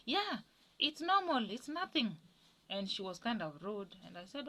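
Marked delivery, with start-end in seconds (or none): 0.1-2.2 dismissive mother. Retold or imitated.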